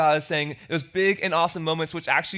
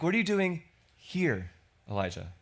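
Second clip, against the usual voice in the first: concerned father; 2 semitones higher, 3 dB quieter than usual. Shown as a demonstration. Preacher voicing God as a caring father. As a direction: ask it with a worried father's care. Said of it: "I read it as a concerned father".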